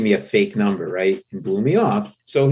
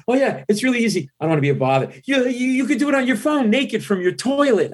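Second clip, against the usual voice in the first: high, flustered babbling; nervous babble; 13 semitones higher than usual. Retold or imitated. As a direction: babble like a starstruck fan. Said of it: "I actually said to [name]".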